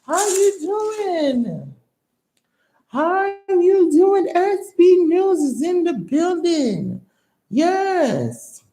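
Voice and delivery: high, loud, drawn-out hype voice; hype announcer. Acted. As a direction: celebrate the donation like an announcer. Hyping a donor who gave twenty.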